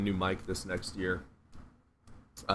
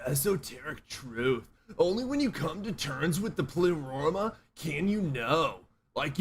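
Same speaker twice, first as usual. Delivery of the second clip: stoner guru voice; 6 semitones higher than usual. Retold or imitated. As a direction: preach cosmic wisdom like a stoned bro. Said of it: "I used to talk like that".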